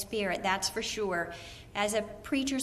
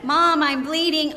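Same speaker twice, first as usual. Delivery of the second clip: urgent, louder call for mom; a kid raising the voice over a distracted parent; higher, loud mock cry for attention; child calling out; 5 semitones higher, 9 dB louder than usual. Child calling to inattentive mother.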